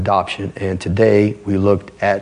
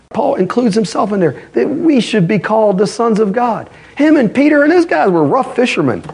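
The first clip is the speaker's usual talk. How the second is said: high, driving sermon voice; raised, urgent pulpit delivery; fiery preaching; 11 semitones higher than usual. Acted. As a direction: preach it hard and fast to the congregation. Preaching at full force.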